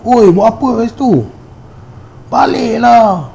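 high calling voice, last word drawn out slowly; retold shout. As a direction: yell at the stranger to go home. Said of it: "Dia menjerit". (laungan tinggi, perkataan akhir dileretkan)

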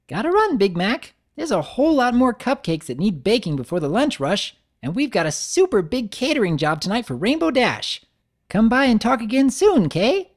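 high, quick and bright pony chatter; bubbly Pinkie voice. Acted. Pinkie hurrying off to bake, high and chirpy. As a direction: rattle it off high, already halfway out the door.

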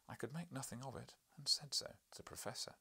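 ordinary delivery

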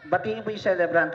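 ordinary delivery

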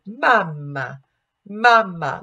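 put-on mamma with a bright open ah, like a baby calling. Shown as a demonstration. Coach has singers check the vowel like a baby. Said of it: "go like a baby".

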